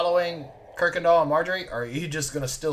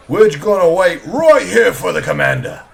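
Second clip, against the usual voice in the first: loud guard; 3 semitones higher, 12 dB louder than usual. Acted. Guard tells elf to wait.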